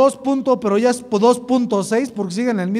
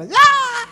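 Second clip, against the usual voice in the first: high, loud, held "ah"; mock scream; 12 semitones higher, 5 dB louder than usual. Preacher shows how he would make them scream, mock scream. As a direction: let out a sudden high yell. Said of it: "ahí los hago gritar".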